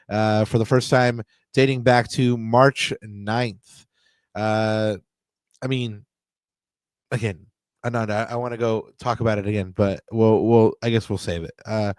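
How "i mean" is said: ordinary talk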